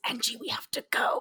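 urgent whisper; hushed, unvoiced warning to an accomplice; covered-mouthpiece aside. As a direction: hiss it privately away from the phone. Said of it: "She covers the mouthpiece".

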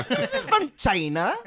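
high, slow mock Indian accent through laughter; bad Indian accent. Topping the delicacy joke, slow high mock Indian accent. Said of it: "like the worst Indian accent".

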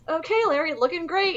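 high-pitched mock friendliness; cheery compliment. Imitating Liza complimenting the house painter, high and chirpy. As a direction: act out an over-bright compliment, pitched high. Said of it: "she was like".

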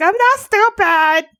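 high, drawn-out mock wail; hammed-up tearful protest; crying. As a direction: wail it like a hurt little kid. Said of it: "I'm crying".

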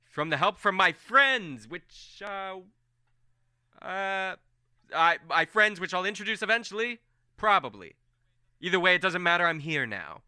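high, loud, slowed bragging; boastful Colm. Acted. Colm boasting to the nobles about his escape, loud and high. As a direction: puff yourself up for the room.

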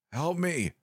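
high, faint cry for help; a weak voice straining through static; lightly voiced feeble caller; feeble plea. A narrator's reading. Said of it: "it said in a feeble, yet insistent voice".

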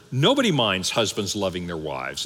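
usual speaking voice

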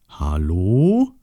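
questioning call, sliding upward; calling into hole. Acted. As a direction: stretch it out and lift the end. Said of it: "rief Osterschweinchen in das Loch".

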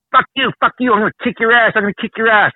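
high, aggressive taunting of the little guy; picking a fight. Retold or imitated. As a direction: yap threats up at the bigger man. Little guy threatens in bar fight.

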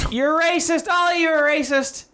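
high, indignant mock accusation; acted accusation. Acted accusation in improvised story.

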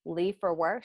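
ordinary delivery

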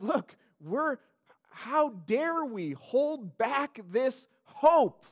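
slow, emphatic plea with punched words; passionate. Acted. Preacher voicing the early church's belief, passionate. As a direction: draw each word out with passion. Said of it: "They said it passionately".

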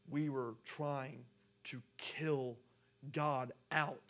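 slow, word-by-word delivery; slow emphasis. Real, not acted. Preacher says we tried to kill God, slowly emphatic. Preacher drives the point home.

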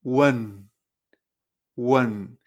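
"Wen" with an e between u and n; Chinese un sound. Shown as a demonstration. Teacher modelling the final for learners, clear "Wen" with inserted e. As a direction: say "Wen" as u-e-n, held and low. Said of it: "como si hubiera una E".

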